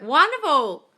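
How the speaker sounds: high, loud, exultant; delighted praise. Real, not acted. Coach praising a follower's result, delighted cry. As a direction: sing out your delight, falling at the end.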